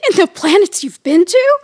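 high, loud questioning alien diner; pretend alien. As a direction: quiz a fellow alien, hammed-up and loud. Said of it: "as if you're an alien".

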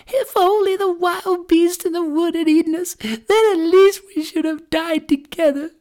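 put-on very high girl's lament; lamenting little sister. A narrator's reading. Gretel fears for her brother, lamenting as a child. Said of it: "how the poor little sister sobbed".

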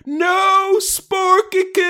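high, drawn-out mock wail; anguished cry. Dog's owner seeing his mastiff shot, anguished wail. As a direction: wail the pet's name in melodramatic grief. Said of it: "one of the men go like".

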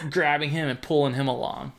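usual speaking voice

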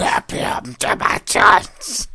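slow, rasping mock-demonic French. Podcaster mimicking the possessed girl's French, demonic rasp. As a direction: draw it out as a hammed-up possessed croak.

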